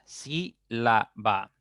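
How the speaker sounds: "Sílaba" very slowly as sí - la - ba; syllable by syllable. Shown as a demonstration. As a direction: spread "Sílaba" over three separate beats, sí, la, ba. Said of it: "Ya tienen tres sílabas".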